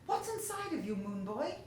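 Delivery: high, loud, rushed outburst; blurting out. Acted. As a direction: let it spill out before he can stop. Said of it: "he blurted out".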